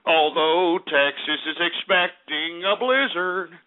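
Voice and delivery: ordinary talk, nothing marked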